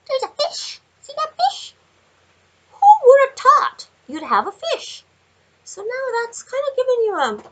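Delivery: very high, excited fish discovery; high, loud wonder at the fish card; delighted squeal. Acted. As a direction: squeal it like a kid finding a prize.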